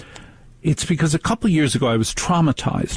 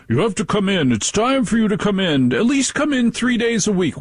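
mock boss; 8 semitones higher than usual. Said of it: "are saying to their workers".

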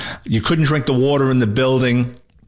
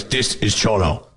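slow, gravelly imitation of an informant; gravelly phone caller; 3 semitones lower, slower than usual. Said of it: "with this gravelly voice".

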